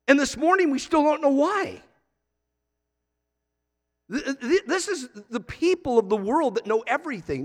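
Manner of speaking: high-pitched, animated disbelief; incredulous preaching. Real, not acted. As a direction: marvel at how little the experts know. Preacher mock-amazed at experts' ignorance.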